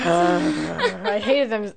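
slow, sighing disgust; real weary exasperation, slow. Real, not acted. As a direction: sigh it out, worn down.

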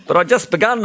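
high, mildly protesting; objecting. A narrator's reading. Milo cut off by the king, objecting. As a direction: protest at being cut short. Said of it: "objected Milo".

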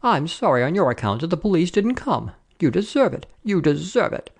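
high, spiteful telling-off; indignant landlady rubbing it in; angry scolding. A narrator's reading. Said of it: "scolded the angry woman".

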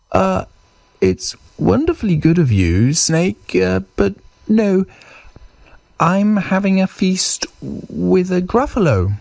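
high, squeaky-small mouse voice; little mouse. Acted. Mouse declines Snake's invitation to a feast, high mouse voice.

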